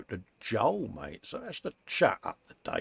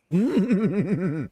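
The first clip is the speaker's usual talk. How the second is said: short high laugh; 6 semitones higher, 4 dB louder than usual. Real, not acted. Host amused by viewer's big telly, short high laugh. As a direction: chuckle out loud.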